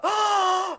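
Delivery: sharp high gasp; a hammed-up collective gasp; mock gasp. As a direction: gasp in fake shock. Everyone gasps at poison.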